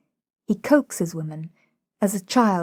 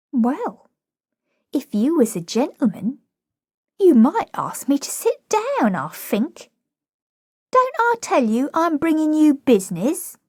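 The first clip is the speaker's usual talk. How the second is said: put-on high-pitched, saucy cockney girl; indignant flower girl; 9 semitones higher, 4 dB louder than usual. Flower girl standing up to Higgins, indignant. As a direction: protest in a high cockney girl's voice.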